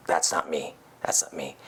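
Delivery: soft whispered self-denial. Retold or imitated. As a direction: whisper it like a secret you're hiding. Child denying own nature.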